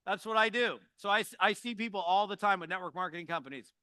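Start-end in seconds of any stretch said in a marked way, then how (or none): none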